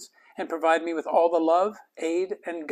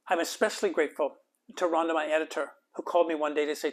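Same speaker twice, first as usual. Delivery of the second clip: ordinary delivery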